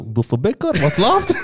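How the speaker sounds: high, laughing mock aggression; mock challenge. Imitating the roommate's cheeky what's up. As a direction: snap it like a street provocation. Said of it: "Und dann du so".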